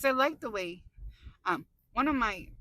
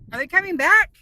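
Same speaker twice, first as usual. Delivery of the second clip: startled; 7 semitones higher, 16 dB louder than usual. Real, not acted. Startled by ambulance.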